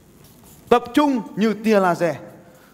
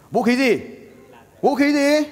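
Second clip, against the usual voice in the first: to someone hard of hearing; 7 semitones higher than usual. Real, not acted. Coaxing a quiet audience to answer.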